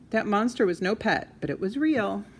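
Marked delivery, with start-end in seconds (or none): none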